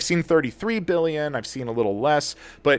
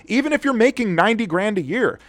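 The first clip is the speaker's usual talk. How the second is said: getting heated; 8 semitones higher than usual. Real, not acted. Vlogger venting to viewers about premiums, heated and raised. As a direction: let the frustration boil over on the money. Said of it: "And I'm getting a little heated".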